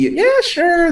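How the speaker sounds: put-on high-pitched little girl agreeing; eager girl impression. Imitating a child actor in the selfie scene, high girlish voice. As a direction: pitch it way up like a keen kid. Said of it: "the girl's trying to be".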